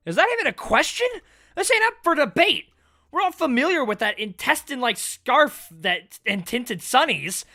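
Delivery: loud, high, fast scoffing challenge; cocky loud villain mocking; brash character. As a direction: bark it out like it's beyond question. Voices game character dialogue.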